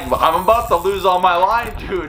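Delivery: high-pitched retold panic, half laughing; mock-frantic, pitch jumping up; urgent plea. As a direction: act out begging a friend to hurry. Retelling urgent plea while losing line.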